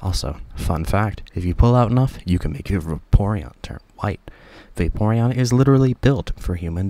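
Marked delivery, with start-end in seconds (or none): none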